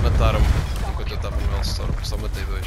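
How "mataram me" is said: frustrated exclamation. Real, not acted. Exclaiming own death.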